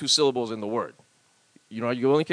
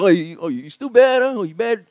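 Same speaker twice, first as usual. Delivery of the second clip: Pidgin pickup line; 4 semitones higher, 5 dB louder than usual. Shown as a demonstration. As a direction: draw out a mock Pidgin flirt. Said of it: "I just wanna be like".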